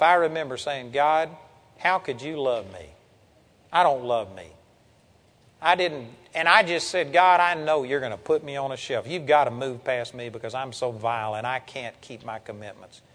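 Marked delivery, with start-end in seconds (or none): 0.9-4.5 low, soft, dejected prayer; retold self-disappointment. Preacher retelling his prayer after failing, quietly disappointed. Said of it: "I got so disappointed with myself". 7.1-13.0 low confession, growing softer; retold dejected confession. Preacher retelling his confession to God, subdued and disappointed. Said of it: "I got so disappointed with myself".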